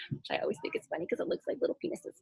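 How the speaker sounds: fast whispered aside to the adults; quick hushed joke under her breath. Real, not acted.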